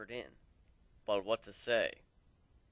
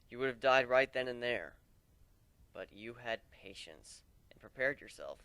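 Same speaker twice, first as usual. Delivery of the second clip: ordinary delivery, nothing marked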